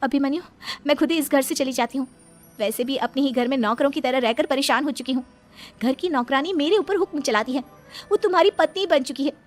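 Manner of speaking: loud, aggrieved declaration; bitter outburst. Acted. Wife telling husband she is leaving home, bitter outburst. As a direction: let the grievance out loudly.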